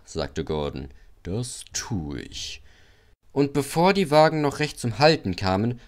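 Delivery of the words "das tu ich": low, soft and very slow; ominous assent. A narrator's reading. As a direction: promise it quietly and slowly, plotting. Big engine answers, plotting revenge.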